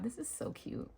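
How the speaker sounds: soft whispered admiration. Real, not acted. Admiring a tiny bow, whispered. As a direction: breathe it out in awe.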